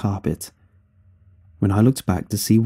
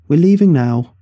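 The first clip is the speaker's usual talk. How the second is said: raised, louder announcement; calling through door; 6 semitones higher, 6 dB louder than usual. A narrator's reading. Mother knocking on son's bedroom door, calling out. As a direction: project it higher to get attention.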